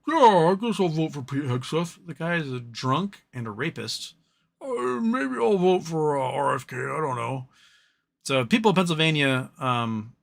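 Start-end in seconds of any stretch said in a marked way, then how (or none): 0.1-7.5 mock the senator as a shrugging, aimless voter; mocking Fetterman. Streamer mocking the senator's careless votes, in a light dopey voice. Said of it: "He's like".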